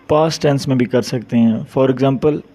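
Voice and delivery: ordinary delivery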